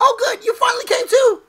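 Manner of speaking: super hype partner Pokemon voice; partner's hype voice. Acted. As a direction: pitch way up like an overjoyed sidekick. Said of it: "This is how I always did my partner's voice".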